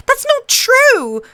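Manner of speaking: put-on a child shouting her denial; indignant girl yelling at the queen; said loudly. A narrator's reading. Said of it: "Arya said loudly".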